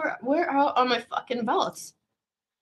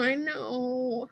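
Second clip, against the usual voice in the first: usual speaking voice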